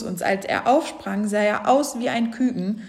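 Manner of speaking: ordinary delivery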